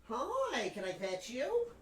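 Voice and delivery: quiet, coaxing voice to a pet; soft pet talk. Real, not acted. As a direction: coo gently at the cat. Sweet-talking a pet.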